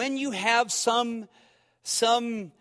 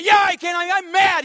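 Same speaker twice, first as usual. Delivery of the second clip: loud high mock-furious shout; faked fury; 11 semitones higher, 9 dB louder than usual. Ballplayer pretending to be mad at his buddy, loud mock fury. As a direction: declare your rage with a straight face. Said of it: "faking anger".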